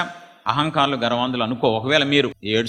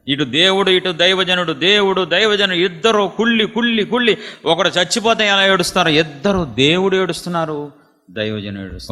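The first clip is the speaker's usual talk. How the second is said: high, loud impassioned preaching; fervent preaching; 6 semitones higher, 3 dB louder than usual. Acted. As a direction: pour your heart out to the congregation. Preaching both weeping. (ఉద్వేగంతో గట్టిగా ప్రసంగం)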